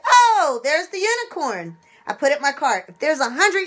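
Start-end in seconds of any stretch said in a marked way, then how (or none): 0.1-1.8 high little "Oh" of discovery; mock delighted find. Penny shopper spotting the unicorn on the shelf, delighted. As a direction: spot the treasure with a bright gasp. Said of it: "and I say".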